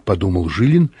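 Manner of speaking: usual speaking voice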